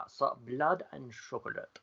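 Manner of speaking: put-on soft, accidentally French accent. Host starting a German film pitch, drifting into French accent. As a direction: try German and slide into French. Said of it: "I'm going French".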